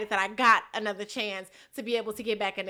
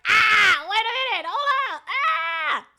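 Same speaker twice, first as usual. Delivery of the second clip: high, louder wait a minute, hold up; jumping in to stop the fight; mock panicked shout to break it up; retold alarmed shout; 8 semitones higher, 5 dB louder than usual. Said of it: "And I'm like".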